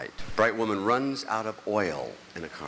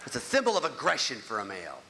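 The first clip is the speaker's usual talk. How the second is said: normal talking voice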